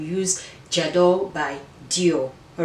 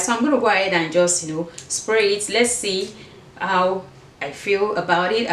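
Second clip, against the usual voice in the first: ordinary delivery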